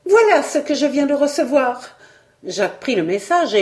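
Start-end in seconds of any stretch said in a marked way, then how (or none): 0.0-2.0 high, anxious, trembling delivery. A narrator's reading. Anguished young woman hands the journalist a note, voice trembling. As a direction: say it high and shaken. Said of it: "en disant d'une voix tremblante".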